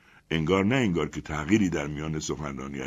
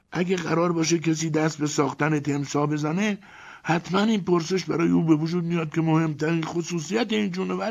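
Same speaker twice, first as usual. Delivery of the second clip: higher voice for Ivan, chatty; Ivan's voice; 12 semitones higher than usual. A narrator's reading. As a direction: lift the voice to play Ivan. Narrator voices Ivan again.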